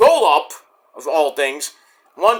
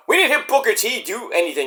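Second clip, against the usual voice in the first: ordinary talk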